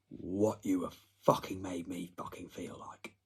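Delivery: hushed menacing whisper; breathy sinister murmur; whispered threat. Real, not acted.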